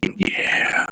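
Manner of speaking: whispering. Real, not acted. Sneaking in dark shack.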